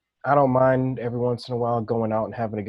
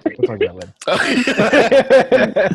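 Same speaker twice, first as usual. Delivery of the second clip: high, slow, laughing mock voice; mock sing-song voice; 9 semitones higher, 5 dB louder, much slower than usual. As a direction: stretch it out high and teasing. Repeated in a put-on voice.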